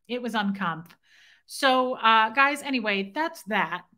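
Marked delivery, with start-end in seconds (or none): none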